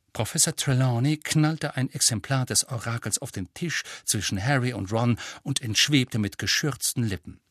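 normal talking voice

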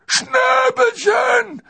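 very high, long, loud wail; overjoyed giant's sobbing call; tearful shout. A narrator's reading. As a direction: cry out the pet name at full voice. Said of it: "rief Hagrid".

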